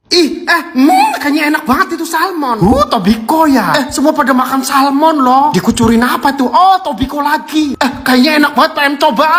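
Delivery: high, wheedling longing voice; whiny craving. Real, not acted. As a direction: whine that you want some. Craving while watching others eat.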